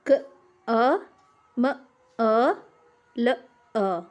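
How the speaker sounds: क, अ, म, अ, ल, अ spoken one sound at a time with pauses; each sound of कमल said on its own; sounds split apart. Shown as a demonstration. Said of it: "उदाहरण के लिए कमल शब्द, इसमें छह ध्वनियाँ हैं".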